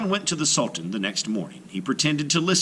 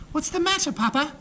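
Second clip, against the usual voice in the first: put-on much higher, light young girl voice; girl's voice. A narrator's reading. Aisha asking her worried father, young high girl voice. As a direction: voice a bright teenage daughter.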